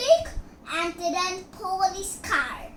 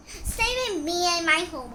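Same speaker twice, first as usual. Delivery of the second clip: ordinary talk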